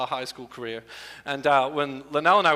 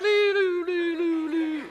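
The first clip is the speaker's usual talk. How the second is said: fading siren; 12 semitones higher, slower than usual. Retold or imitated. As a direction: let the siren fade off into the distance. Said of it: "the Doppler effect".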